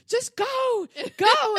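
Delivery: loud, high frantic urging; urgent shout. Retold or imitated. Teachers shout at the mom to leave now, loud and urgent. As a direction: wave her off, shouting.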